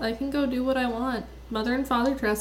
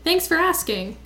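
bitter sarcasm; 6 semitones higher, 5 dB louder than usual. Acted. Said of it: "Slightly bitter".

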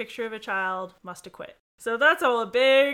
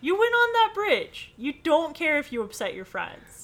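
high, loud outburst of protest; mock indignation; 8 semitones higher, 6 dB louder than usual. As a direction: scold the heroine in mock outrage. Host indignantly argues with the book.

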